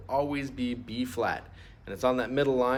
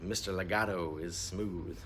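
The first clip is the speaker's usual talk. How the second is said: slow, soft and smooth; 5 semitones lower, 6 dB quieter, slower than usual. Shown as a demonstration. Teacher demonstrates legato by speaking smoothly. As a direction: glide through it smoothly like a legato line. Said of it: "Everybody say legato".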